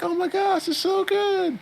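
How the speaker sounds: put-on very high, gushing girl voice; delighted little girl. Retelling the anime, the girl gushes over the cake in a very high voice. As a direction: squeal it like a kid tasting cake. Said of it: "And she's like".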